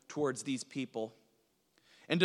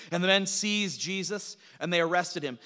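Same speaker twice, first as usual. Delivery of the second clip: usual speaking voice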